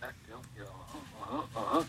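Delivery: low, hushed wrestler grunts; quiet Macho Man. Acted. Showing the favorite version of the wrestler, very quiet. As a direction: sink back to a very soft, low wrestler murmur. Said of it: "That's my favorite version".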